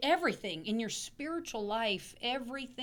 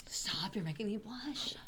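soft, whispery bashful reply; bashful hush; 3 dB quieter than usual. Real, not acted. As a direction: go hushed, as if shy at the praise.

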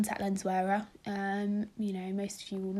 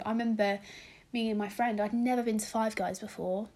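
normal talking voice

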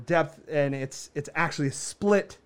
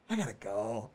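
low, much softer aside; muted retreat; 7 semitones lower, 10 dB quieter than usual. Retold or imitated. Man slipping away from the neighbors' fight, muted. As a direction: say it under the voice, backing out. Backing out of the quarrel.

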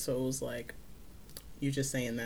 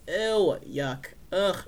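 disgusted groans; 4 semitones higher, 4 dB louder, much slower than usual. Real, not acted. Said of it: "I'm disgusted".

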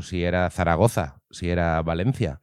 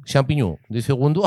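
higher, louder put-on Belgian voice; mock Belgian accent; 7 semitones higher, 6 dB louder than usual. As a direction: Belgian waiter reading the menu, hammed up. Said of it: "pronunciar así".

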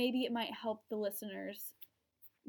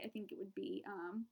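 ordinary talk

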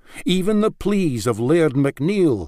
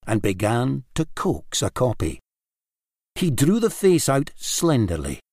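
usual speaking voice

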